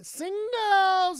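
high loud sing-song; sing-song call. Pretend. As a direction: call it out like a tune.

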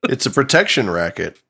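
ordinary talk

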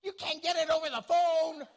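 high, loud comic outburst; incredulous shout. Acted. Pastor mocking someone who hung up over covid, incredulous shout. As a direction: holler it in exasperated disbelief.

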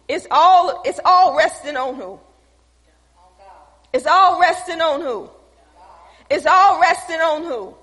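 loud repeated preaching question; preaching call. Acted. Preacher calling to the congregation, loud and insistent. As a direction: drive the question home to the church.

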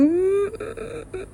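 high, thin, soft whimper; tearful protest. Real, not acted. Speaker protesting it's not fair, tearful whimper. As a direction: squeeze it out high and faint, with long breaks.

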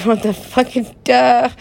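high, drawn-out baffled outburst; incredulous, dragging the last word; exasperated disbelief. Retold or imitated. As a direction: stretch it out, loud and high in disbelief. Quotes own baffled reaction.

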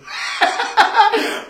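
a short airy burst of laughter; breathy laugh. Real, not acted. Speaker laughing at his own frustration in the store, a short airy burst. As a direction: laugh at yourself.

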